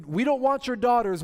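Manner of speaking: mild mock mob demand; voicing the mob. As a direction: demand it from outside the door.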